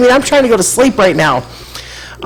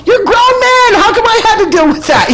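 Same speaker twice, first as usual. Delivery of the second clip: much higher, laughing mock scold; retold scolding; 4 dB louder than usual. Scolding the grown man who didn't step in, high laughing scold. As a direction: tease him, high and incredulous.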